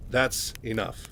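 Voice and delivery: level, measured, firm; Mike, very serious. Retold or imitated. Mike reiterates his warning to Raynor, serious and controlled. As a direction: repeat it soberly without raising the voice. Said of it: "Mike is very serious right now".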